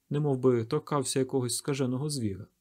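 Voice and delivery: ordinary delivery, nothing marked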